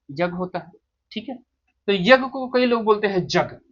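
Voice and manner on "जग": "जग" with j in place of y; hard j opening on "जग"; wrong pronunciation. Shown as a demonstration. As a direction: say यज्ञ as "जग". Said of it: "ये जग नहीं है".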